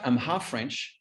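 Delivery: ordinary talk, nothing marked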